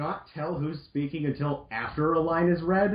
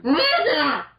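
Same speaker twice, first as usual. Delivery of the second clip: much higher, louder hammed-up cry; mock joyful cry; 11 semitones higher, 5 dB louder than usual. Roll seeing Mega Man save her, loud mock cry. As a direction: cry his name in relief.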